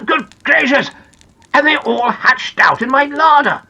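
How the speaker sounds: high, emphatic storybook exclamation; astonished cry. Acted. Big Ears realises eggs hatched in his larder, amazed. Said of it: "cried Big Ears".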